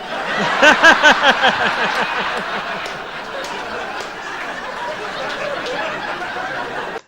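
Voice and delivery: high, loud burst of laughter. Real, not acted. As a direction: crack up hard. Host laughing at punchline.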